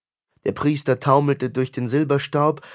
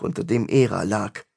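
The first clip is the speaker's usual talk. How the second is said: ordinary delivery, nothing marked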